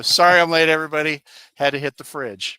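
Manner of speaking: loud, hammed-up entrance line; mock announcement. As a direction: announce it to the whole room. Acting out entering the meeting.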